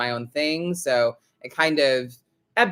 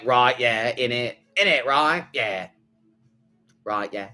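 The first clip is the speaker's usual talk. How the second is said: put-on British accent; 4 semitones lower than usual. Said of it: "him ad-libbing".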